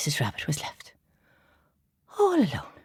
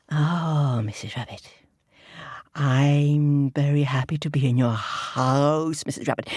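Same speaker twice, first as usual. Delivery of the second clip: sly fox; 4 semitones lower than usual. Acted. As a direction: stretch it out with sly relish. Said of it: "said Foxy".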